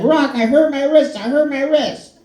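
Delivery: high, whining cry of pain; high pitched whine about a sore wrist; whiny high complaint. Retold or imitated. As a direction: whimper it to him like a hurt kid. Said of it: "I was like".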